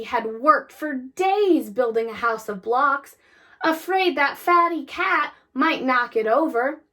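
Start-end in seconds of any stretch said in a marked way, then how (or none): none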